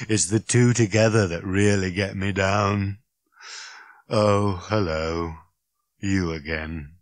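slow, dreary robot groan; worn-out robot's lament. Acted. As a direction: drone it out, weary of existence. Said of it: "it groaned".

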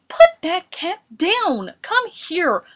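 high, slow, commanding yell; Boris. A narrator's reading. Boris ordering Morris to drop the cat, yelling. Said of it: "Boris yelled".

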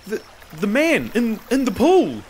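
high, halting, rattled answer; nervous stammer. A narrator's reading. Slave names the culprit to master, stammering.

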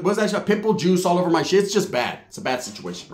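ordinary talk

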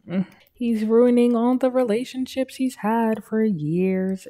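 ordinary talk, nothing marked

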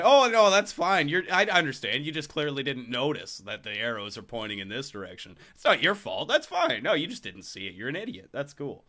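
exaggerated high swoops, mock-understanding; condescending. Shopper excusing someone who missed the arrows, condescending. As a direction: excuse them with sugary, put-on condescension. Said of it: "condescending play, like, power play".

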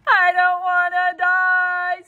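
high slow loud scream. Acted. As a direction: wail it at the top of his lungs. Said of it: "screamed Wilbur".

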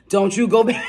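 mock warning broken by a laugh; laughing scold. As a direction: wag a finger but crack up mid-line. Scolding viewer about going back.

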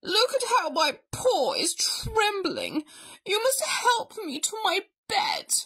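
high, quavery invalid act; hammed-up sick bear; wheezing bear. A narrator's reading. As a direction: plead pitifully as a failing bear. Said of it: "Bear wheezed".

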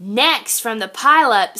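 plain reading voice

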